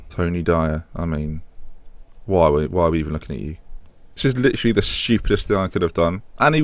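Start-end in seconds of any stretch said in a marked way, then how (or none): none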